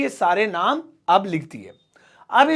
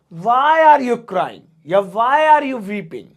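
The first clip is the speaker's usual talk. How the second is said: slow dictation; 4 dB louder, much slower than usual. Shown as a demonstration. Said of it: "dictating sentences slowly".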